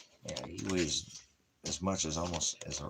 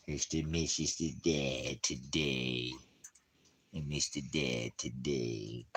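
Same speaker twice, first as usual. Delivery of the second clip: cooing to dog; 3 semitones lower, 4 dB quieter, slower than usual. Real, not acted. Man talking to his dog.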